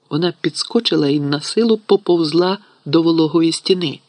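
ordinary delivery, nothing marked

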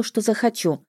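ordinary delivery, nothing marked

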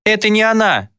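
put-on high, frantic boy's denial; high-pitched insistent cry; child's protest. A narrator's reading. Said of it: "запротестовал я".

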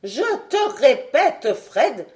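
plus haut et plus fort, lent et appuyé; le docteur martèle sa phrase; vieux savant insistant. Acted. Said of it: "prononça le docteur". (le savant corrige Fred, voix ferme et forte)

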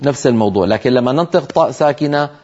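normal talking voice

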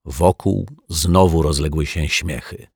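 ordinary delivery

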